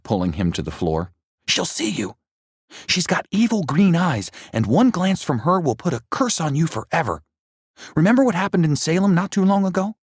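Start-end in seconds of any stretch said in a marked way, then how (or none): 1.5-10.0 put-on high, urgent boyish warning, rushing at the end; frightened boy. A narrator's reading. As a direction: warn him breathlessly, speeding up. Frightened boy warns of witch.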